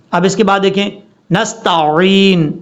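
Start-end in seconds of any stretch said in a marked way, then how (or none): 1.3-2.5 slow 'نستعین' with throaty ع; clear ain. Shown as a demonstration. Teacher presents the word, slow correct recitation. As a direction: squeeze and stretch the end of 'نستعین'. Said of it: "تا کے بعد عین".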